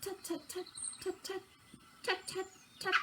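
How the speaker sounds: high, very soft tut tut tut; imitating a robin's soft notes. Shown as a demonstration. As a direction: peep gently like a nesting robin. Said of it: "She softly sings three notes".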